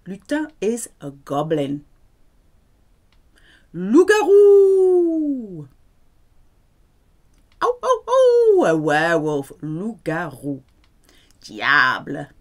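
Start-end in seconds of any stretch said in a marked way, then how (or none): 3.7-5.7 stretched-out wolf howl; werewolf howl. Acted. Teacher howling the French word for werewolf, wolf howl. 7.6-8.6 high, loud howling cries; wolf howling. Acted. Teacher acting out a werewolf, loud high howling.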